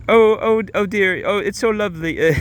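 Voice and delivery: high, chipper mock-British woman; badly faked British accent. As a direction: gush in a high, put-on British voice. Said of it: "I can't do a British accent".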